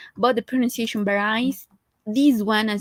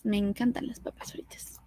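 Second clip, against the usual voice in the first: whisper; 10 dB quieter than usual. Real, not acted. Whispered confession about fries.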